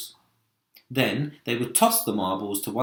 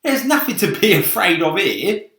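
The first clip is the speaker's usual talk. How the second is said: scoffing centipede; 9 semitones higher, 9 dB louder than usual. Acted. Centipede voiced in story.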